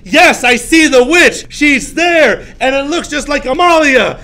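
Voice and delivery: high, loud mock accusation; hammed-up shouted sighting; acted little girl accusing. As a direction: cry it out like a girl spotting a witch. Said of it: "the little girl would be like".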